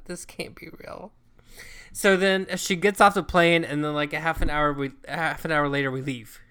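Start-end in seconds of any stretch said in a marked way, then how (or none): 0.0-1.1 breathy whisper, barely voiced; whispered disbelief. Whispering to herself at the sobbing passenger on the plane. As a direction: whisper it like you can't believe your eyes. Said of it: "I'm like".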